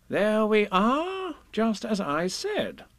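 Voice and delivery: high, slow, self-satisfied Rabbit; proud stage whisper. A narrator's reading. Rabbit proved right to friends, high, slow and proud. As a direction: draw it out high and proudly, only half whispered. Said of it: "he whispered proudly".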